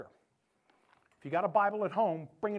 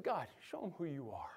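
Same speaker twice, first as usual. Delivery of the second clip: simple quiet prayer; 4 semitones lower, 7 dB quieter than usual. Retold or imitated. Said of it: "But Elijah just said".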